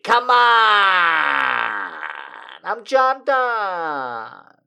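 loud, high mock Jersey lover; Jersey accent. Streamer playing John Donne as a Jersey guy, hammed-up Jersey accent. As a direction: ham up a New Jersey guy sweet-talking his lady. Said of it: "Why does he have that accent".

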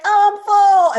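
high, loud wail; mock plea. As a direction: wail it out in playful protest. Women pleading they are full.

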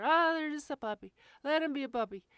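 high, loud, mock-indulgent owner voice; mocking other owners. Trainer mocking owners who excuse their puppy, high loud mock-indulgent.

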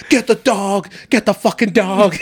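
high, loud urgent command; barked police order. Retold or imitated. Retelling cops yelling about the dog, urgent loud order. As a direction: order them to grab the dog.

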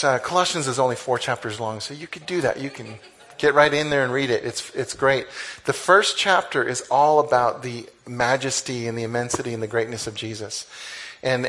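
usual speaking voice

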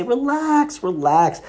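high, drawn-out relax, relax; mock calm friend. Imitating his roommate telling him to relax, high and lilting. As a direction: mimic the unbothered roommate soothing you. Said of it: "But he would say".

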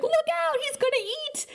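high-pitched mock panic; mock alarmed cry. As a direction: squeal a warning as he lunges. Cordelia warns as Angel dives.

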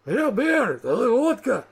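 high, loud, swooping orc voice; heckling orc. Acted. Orc asking the brewer for drink, eager high heckle. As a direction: pipe up eagerly from the crowd.

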